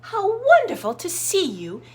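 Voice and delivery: high, loud chirpy greeting; chirping bird. Acted. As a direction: chirp hello like a little bird. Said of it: "she chirped".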